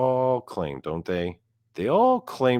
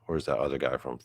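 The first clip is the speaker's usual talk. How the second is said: ordinary talk, nothing marked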